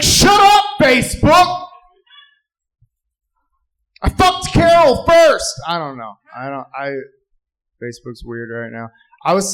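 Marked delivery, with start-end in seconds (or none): 0.0-5.5 high-pitched acted shout of outrage; indignant outburst. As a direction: yell back, exasperated and high. Acted angry retort to Facebook.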